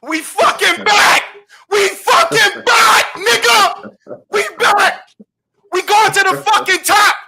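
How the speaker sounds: high, loud, slow shouts of triumph; wild celebration shout. Real, not acted. San Antonio fan shouting after the game seven win, wildly excited. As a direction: roar it out like your team just won the title. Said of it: "I gotta get emotional".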